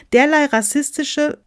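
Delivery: high, loud reprimand; indignant. Acted. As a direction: protest sharply until cut off. Officer protests, gets cut off.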